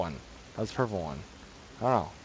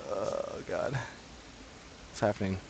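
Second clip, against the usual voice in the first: hushed fear; 3 semitones lower, 11 dB quieter than usual. Real, not acted. Tense, hushed while playing.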